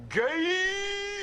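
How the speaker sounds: shrill falsetto squeak of the word; mock squeal. Mocking the casting with a falsetto squeal. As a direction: squeak it way up high like a cartoon.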